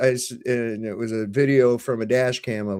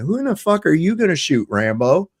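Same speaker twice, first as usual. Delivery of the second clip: high-pitched indignant challenge; retold angry challenge; 6 semitones higher, 3 dB louder than usual. Father confronting cop with gun drawn, retold angry challenge. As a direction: challenge him, pitched well up.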